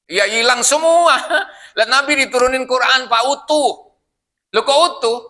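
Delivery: high and loud with laughter; preacher joking with the congregation; lively joking. Real, not acted. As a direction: tease them with a grin.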